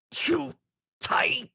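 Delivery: squeezed, breathless croak; wheezing. A narrator's reading. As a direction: gasp it out while crushed. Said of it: "Tom wheezed".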